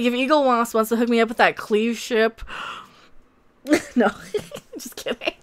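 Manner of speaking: normal talking voice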